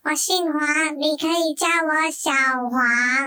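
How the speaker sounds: normal talking voice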